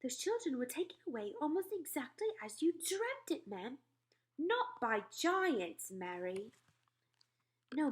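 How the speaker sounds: calm the queen as her maid; a maid reassuring her mistress; maid's soothing voice. Acted. Maid explains her shock.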